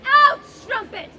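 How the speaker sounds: slow, loud, savage roar; furious shout. Acted. Husband roaring at his weeping wife, enraged. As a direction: spit the insult out with full force. Othello turns on Desdemona.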